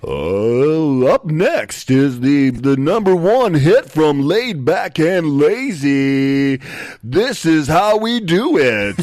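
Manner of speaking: loud, high, drawn-out DJ intro; big swooping slow radio-announcer hype; best radio voice. Shown as a demonstration. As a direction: announce the next hit like a hyped radio host. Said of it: "Use your best radio voice".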